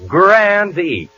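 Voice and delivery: ordinary talk, nothing marked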